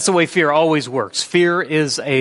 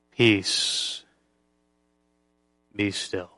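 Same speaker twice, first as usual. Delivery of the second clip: soft Peace be still; 5 semitones lower, 5 dB quieter, much slower than usual. Acted. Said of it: "softly repeats Jesus' words".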